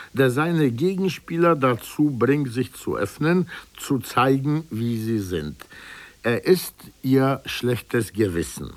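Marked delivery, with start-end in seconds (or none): none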